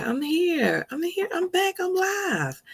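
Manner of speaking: high, bright calls of I'm here, I'm back; excited announcement. Real, not acted. Host greeting viewers as she comes back live, excited. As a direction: proclaim your return to the stream.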